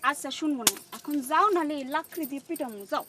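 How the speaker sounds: loud scolding, shooing him away; angry threat. Acted. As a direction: shout at him to leave now. She threatens with firewood. (রেগে চেঁচিয়ে তাড়ানো)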